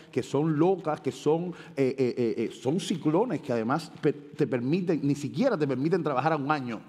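normal talking voice

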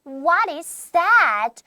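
slow, loud, clearly separated words; slow, precise reading. Shown as a demonstration. Teacher models a question for students, slowly and precisely. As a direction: project the question slowly for learners. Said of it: "천천히 정확하게 읽어주면 돼요".

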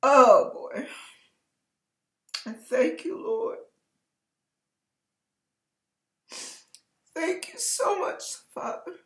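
choked-up thanks, voice catching; emotional. Real, not acted. Pastor overcome while thanking the Lord, tearful. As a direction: fight back tears while thanking God. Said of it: "I'm getting emotional".

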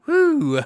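high, loud "woo" through a laugh; laughing whoop. Real, not acted. Laughing at own purchase mistake.

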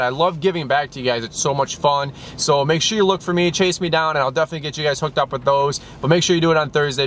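usual speaking voice

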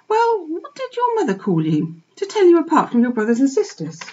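gentle mother dog. Acted. Fly gently questions the piglet.

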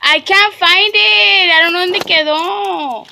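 high, loud, drawn-out complaint; frustrated whine. Real, not acted. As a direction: wail it slowly in frustration. Frustrated complaint about a lost thing.

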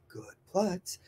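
very soft, calm praise; gentle praise. Shown as a demonstration. Trainer calmly praising a puppy back in down. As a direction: murmur it kindly to the dog. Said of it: "talking to them calmly, gently, nicely".

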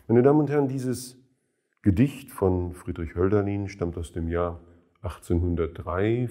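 ordinary delivery

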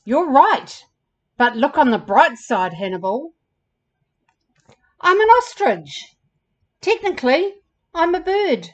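storybook ostrich bucking up a friend; lightly voiced, upbeat ostrich; encouraging ostrich character.

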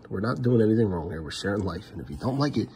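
ordinary talk

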